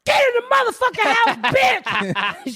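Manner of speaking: high mock yell at the neighbor's child; retold yell. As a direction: order the kid inside, breaking into a laugh. Yelling at girl on roof.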